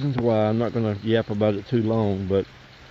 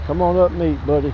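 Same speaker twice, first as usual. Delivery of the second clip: higher, louder call to a duck; 6 semitones higher, 3 dB louder than usual. Real, not acted. Man calling his new duck over for feeding, coaxing.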